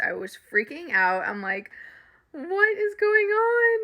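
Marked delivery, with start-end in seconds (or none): 2.3-3.8 squealed disbelief. Retold or imitated. Retold freaking out in car.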